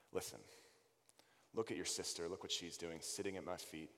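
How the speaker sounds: soft, tender rebuke; gentle Jesus voice. Acted. Jesus gently calling Martha to her sister's example, softly. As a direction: speak low, lovingly correcting her.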